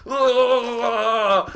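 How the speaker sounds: loud, high wordless yell; garbled cry against blasting air; strained wail. Retold or imitated. Jumper with air pushing into his brain, loud strained wail. Acts out air pressure in face.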